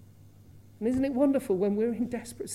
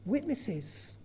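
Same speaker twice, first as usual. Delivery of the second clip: ordinary talk, nothing marked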